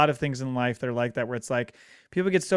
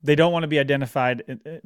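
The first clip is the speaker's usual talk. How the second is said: ordinary talk, nothing marked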